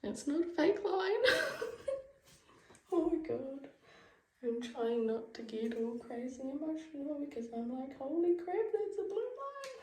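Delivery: high, tearful and giddy; barely holding it together; emotional excitement. Real, not acted. Overwhelmed by positive test.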